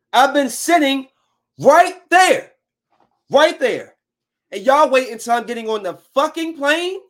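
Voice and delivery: angry rant. Retold or imitated. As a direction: chew out the airline agent, loud and high. Acted angry quarrel with airline agent.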